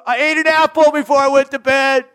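put-on high, loud child's voice making an excuse; high loud kid fibbing to mom; sheepish kid excuse. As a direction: squeak out a kid's lame excuse.

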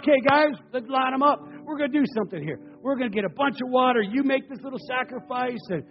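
preacher voicing a bold prophet; commanding Elijah. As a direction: bark out orders to the crowd.

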